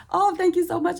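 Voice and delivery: high, bright-eyed gush of thanks; flattered thanks. Retold or imitated. Replying to the food bank girl's compliment, high flattered thanks. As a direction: squeal a grateful thank you.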